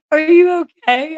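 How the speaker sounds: a high, loud, slow question broken by laughter; laughing concern. Real, not acted. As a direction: ask if he's alright but keep cracking up. Laughing while asking about fire.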